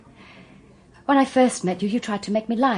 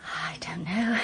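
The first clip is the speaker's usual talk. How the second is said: breathless whisper of thanks; whispered plea; 3 semitones lower, 3 dB quieter, slower than usual. Acted. Joyce pressing her thanks on the barrister, whispered. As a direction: barely voice it, pleading.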